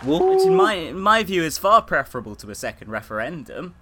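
loud, high, excited comment. Real, not acted. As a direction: cheer the idea on. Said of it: "it's no wonder she got a rousing standing ovation".

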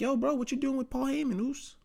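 lightly voiced cousin questioning him; imitating Jay. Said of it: "Jay's like".

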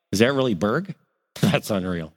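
put-on gruff disbelief of an old teacher; imitating old teacher. Teacher sees former student onstage.

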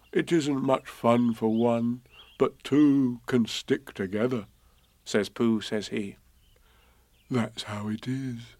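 lightly voiced bear, slow; thoughtful teddy bear; slow gentle Pooh; slow soft Pooh.

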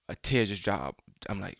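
normal talking voice

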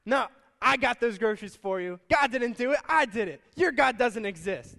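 loud, mocking gotcha; gloating taunt. Atheist neighbor springing out to mock her, loud gloating. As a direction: jump out and rub it in. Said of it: "he's like".

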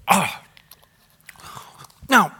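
ordinary talk, nothing marked

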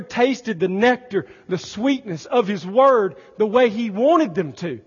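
loud, high, rising preacher's cry; full-force pulpit exclamation; fervent preaching. Acted. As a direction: preach it with mounting awe.

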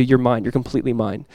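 soft, low, intimate voice; hushed fatherly reassurance; tender assurance. Acted. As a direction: claim them quietly and lovingly. God speaking over the believer.

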